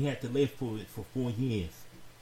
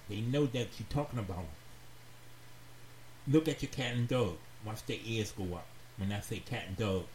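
ordinary talk, nothing marked

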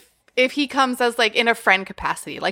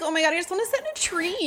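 put-on quick, high, softer girlish voice; imitated giddy girl; 7 semitones higher, 6 dB quieter, faster than usual. Girl at the lake wanting a tree, imitated giddy. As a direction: rush it out as the whimsical girl.

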